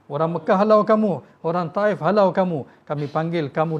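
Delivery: high, loud, fervent preaching cry; impassioned preaching. Acted. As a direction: lift it with full force at the climax. Preaching climax, Allah's words. (laungan penuh semangat)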